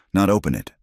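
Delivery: ordinary delivery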